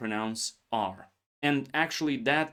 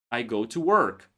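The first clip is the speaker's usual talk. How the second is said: American work; 3 dB louder than usual. Shown as a demonstration. Teacher shows American version to learners. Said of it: "you sound like an American".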